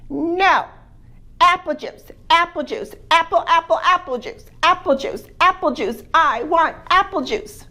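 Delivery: put-on high, loud whiny toddler chant; baby voice. Little baby to his family at breakfast, whiny high demand. Baby refuses orange juice.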